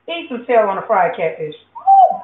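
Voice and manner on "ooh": ooh; a high falsetto ooh of pure delight; delighted squeal. Real, not acted. Tasting fried catfish, delighted.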